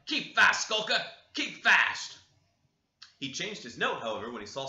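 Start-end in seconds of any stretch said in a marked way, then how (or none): 0.0-2.2 loud, higher shout at the dog; servant shouting. Acted. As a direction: bellow to the dog to hold on. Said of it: "at last shouting".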